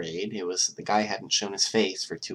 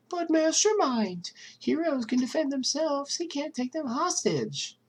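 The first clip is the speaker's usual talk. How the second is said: much higher mocking falsetto of a doubter; mock viewer voice. Mastermind mimics doubtful viewers, high mocking voice. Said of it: "I know what you're thinking".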